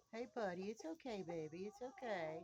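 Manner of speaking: calm the dog, high and gentle; soothing a scared dog. Real, not acted. Calming the dog Buddy.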